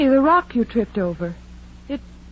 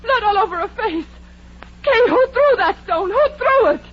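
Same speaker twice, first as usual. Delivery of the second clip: high, breathless alarm; frantic after the blow; shocked panic; 9 semitones higher than usual. Acted. Kay cries out in panic over bleeding Edna.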